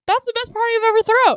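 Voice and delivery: very high, loud robot cheer; Claptrap. Retold or imitated. Imitating a robot delighted at the end of his party, high and loud. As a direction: shout it in a squeaky-high, thrilled voice. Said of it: "he was like".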